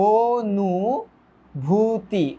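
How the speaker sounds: অনুভূতি very slow, "o-nuu-bhuu-tii"; syllable by syllable. Shown as a demonstration. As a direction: stretch each syllable of অনুভূতি separately. Splitting a word into syllables. (ভেঙে ভেঙে, টেনে টেনে)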